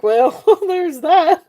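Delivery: high, slow, amused mock reply; dry amused quote with a laugh; wry aside. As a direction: drawl it out with a laugh, half-joking. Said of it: "I was like".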